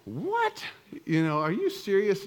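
high shocked What, then a mock disbelieving question; mock-stunned what; stunned disbelief. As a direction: act floored by the remark. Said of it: "just driving like".

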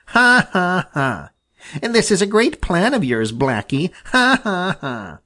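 high mocking ha-has around a taunt; Chatterer jeering. Squirrel mocking the crow's failed plan, jeering laughter. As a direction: laugh at him, then needle him. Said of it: "he shouted".

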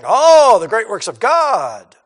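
mock amazed crowd. Preacher mocking the fickle crowd, loud gushing awe. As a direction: ham up the crowd's wonder.

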